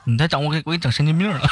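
usual speaking voice

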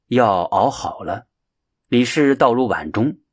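ordinary delivery, nothing marked

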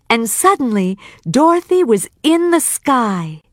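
usual speaking voice

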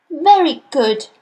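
usual speaking voice

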